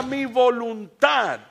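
high, loud, drawn-out climax; preacher's shout. Real, not acted. Preacher at the climax on surrendering to God, shouted. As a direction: shout it out slowly to the congregation.

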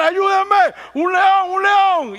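high, loud terrified scream; screaming for help. Retold or imitated. The man in the gorilla suit cries for help, loud terrified scream. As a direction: yell for help as if the lion will eat you. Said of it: "se empezó a estar a gritar".

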